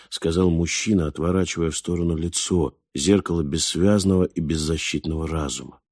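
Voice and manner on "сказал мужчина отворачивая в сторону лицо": ordinary delivery, nothing marked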